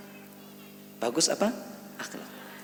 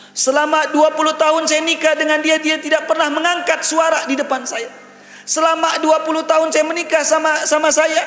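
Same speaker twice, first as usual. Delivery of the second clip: high, sustained declamation; loud, high preacher's testimony; impassioned preaching; 12 semitones higher, 5 dB louder than usual. Retold or imitated. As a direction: proclaim the imam's testimony in a raised voice.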